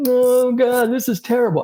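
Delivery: high-pitched exaggerated moan; mock despair. Coach mimics a golfer dwelling on mistakes, mock despair. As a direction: wail it like a self-pitying golfer. Imitates golfer moaning over bad shot.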